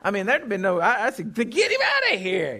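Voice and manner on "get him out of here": high, drawn-out comic order; mock dismissal. Preacher imagining tossing Jonah overboard, mock dismissal. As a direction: jokingly send him packing, stretching the words.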